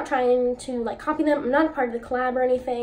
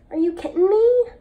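excited; 8 semitones higher, 8 dB louder than usual. Real, not acted. Amazed at swatch.

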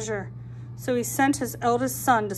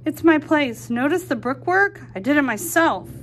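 louder and a bit higher, showing off; proud pig voice; 4 semitones higher, 5 dB louder than usual. Acted. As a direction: boast about the brickwork. Pig shows off his house.

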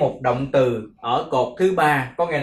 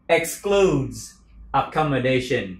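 ordinary delivery

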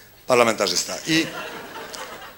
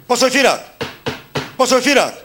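calling out; 7 semitones higher than usual. Retold or imitated. Marshal calling out for absent MP.

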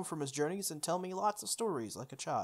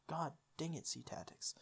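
unvoiced, much softer grumble; whispered curse; 11 dB quieter than usual. Real, not acted. Player whispering a curse at the game. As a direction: hiss the curse under your breath.

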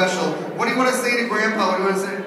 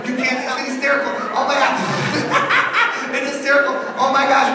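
laughing hysterically; 6 semitones higher than usual. Pretend. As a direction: laugh harder and harder at the funniest movie. Hypnotist acts out hysterical laughter.

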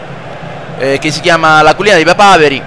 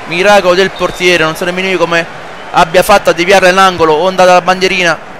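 live play-by-play; 3 semitones higher than usual. Acted. Plays football video game commentating.

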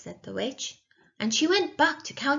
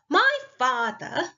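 slow, higher-pitched haughty princess; 5 semitones higher, 4 dB louder, slower than usual. A narrator's reading. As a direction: pull rank on the witch. Princess pulls rank on the witch.

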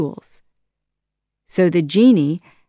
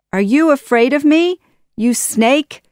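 quick, high, loud taunt; defiant cry; 8 semitones higher, 6 dB louder than usual. A narrator's reading. Aladdin taunts Jafar in a fight, crying out defiantly. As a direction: cry out the challenge. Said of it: "cried Aladdin".

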